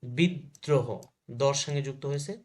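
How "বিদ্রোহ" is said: বিদ্রোহ said bid-dro-ho, d held; doubled দ. Shown as a demonstration. Teacher shows students doubling, বিদ্রোহ as bid-dro-ho. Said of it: "double হয়ে গেছে".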